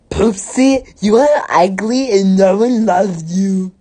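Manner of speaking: high, loud hammed-up taunt; mean bully voice. A narrator's reading. Bertha taunts Poopsie at lunch, high mocking bully voice. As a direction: jeer it loudly in a high put-on voice. Bertha's insult in story read aloud.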